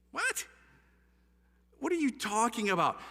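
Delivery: high-pitched, baffled outburst; incredulous reaction. Retold or imitated. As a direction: replay your disbelief at what you read. Retelling stunned reaction to the book.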